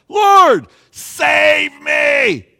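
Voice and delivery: high, loud, drawn-out scream for help; full-throated plea of a drowning man; desperate cry. Shown as a demonstration. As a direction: cry out in fear and dependence. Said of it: "He cries out. It's a cry of desperation".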